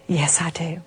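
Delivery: unvoiced whisper; whispered answer. Old man answering her, in a whisper. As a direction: whisper the reply.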